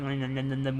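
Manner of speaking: low, soft mock munching; imitating munching. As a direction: mumble quick little eating noises. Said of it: "like, he's going".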